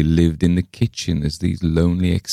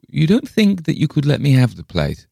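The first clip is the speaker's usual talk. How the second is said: high, businesslike request; brisk; 10 semitones higher, 4 dB louder than usual. A narrator's reading. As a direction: ask it briskly, pitched up. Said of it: "she asked briskly".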